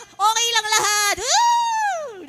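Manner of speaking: hammed-up drugged-out cheer; mock floating high. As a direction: play someone blissfully out of it. Imitating someone high.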